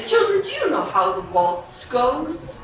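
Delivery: plain reading voice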